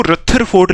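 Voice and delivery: "रथरफोर्ड" with an aspirated th in the middle; mispronounced name. Shown as a demonstration. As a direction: misread "रथरफोर्ड" with th instead of a d sound. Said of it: "इसको रथरफोर्ड पढ़ रहे हैं वो गलत है".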